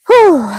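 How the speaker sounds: high, loud exhale; puffed after effort; loud exerted whew. Real, not acted. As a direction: blow out a big whew. Catching breath after exertion.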